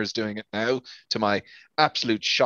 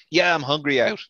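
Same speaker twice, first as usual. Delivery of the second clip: mocking acted reply; 5 semitones higher, 4 dB louder than usual. Acts out the reply he hates.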